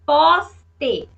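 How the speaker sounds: "poste" broken into pos, te; teacher sounding out "poste" syllable by syllable; split into syllables. Shown as a demonstration. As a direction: say "poste" in two separate beats, firmly. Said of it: "eu separei".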